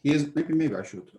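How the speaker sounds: normal talking voice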